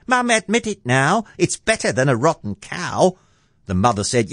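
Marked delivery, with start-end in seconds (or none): 0.1-3.2 high, insistent little lad; boy crying out. A narrator's reading. Jack urging his mother to admit it, boy crying out. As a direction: plead the case in a high boy's voice. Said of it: "Young Jack cried".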